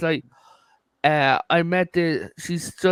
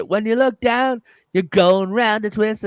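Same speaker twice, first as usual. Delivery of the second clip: "When you look down, you're going round a twist" sung higher in an Australian accent; light sung Australian accent imitation; 4 semitones higher, 4 dB louder than usual. Shown as a demonstration. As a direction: croon the line with an Australian accent, pitched up. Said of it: "but it was sung in an Australian accent".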